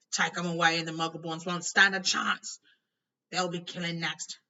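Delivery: loud frantic yell. A narrator's reading. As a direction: shout the warning. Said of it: "yelled Hagrid".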